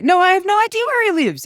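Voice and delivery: guilty nervous high-pitched protest; voice shooting up. Retold or imitated. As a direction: squeak it out like you're caught. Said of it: "my voice goes up three octaves".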